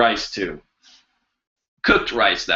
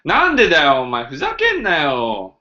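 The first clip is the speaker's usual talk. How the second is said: Tokyo accent; 4 semitones higher, faster than usual. Shown as a demonstration. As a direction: snap it out in Tokyo dialect. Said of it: "I'll give you guys an example of Tokyo accent".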